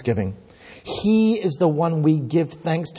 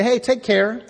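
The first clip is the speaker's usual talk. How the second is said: high, rising friendly send-off; casual farewell; 6 semitones higher than usual. Acted. Preacher mimicking an everyday goodbye, casual and lilting. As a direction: toss it off like a cheery goodbye. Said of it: "sometimes we say".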